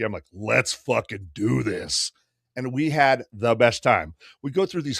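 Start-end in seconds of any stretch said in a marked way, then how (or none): 0.3-2.1 retold resolve, drawn out and lower; slow, determined. Passenger answering nervous friends, slow and determined. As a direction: stretch each word out with relish.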